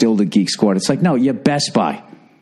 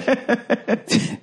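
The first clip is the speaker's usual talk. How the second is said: high laugh; 12 semitones higher, 5 dB quieter than usual. Real, not acted. Laughing at valley joke.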